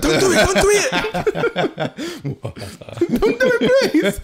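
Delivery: high, laughing panicked plea; frantic pleading. Retold or imitated. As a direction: beg them frantically while laughing. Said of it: "And I'm like".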